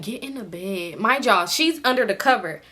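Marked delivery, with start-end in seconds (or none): 0.0-1.0 much softer murmured invite; hushed urging. Retold or imitated. Drunk friend whispering to come under the covers, hushed. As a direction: quietly wave her into the bed.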